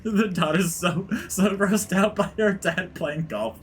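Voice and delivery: high, slow words squeezed out while laughing; talking through laughter. Real, not acted. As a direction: struggle to speak while cracking up. Laughing at own skit.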